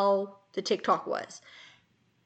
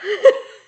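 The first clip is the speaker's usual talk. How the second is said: burst of laughter; 13 semitones higher, 14 dB louder than usual. Real, not acted. Laughing at friend's joke.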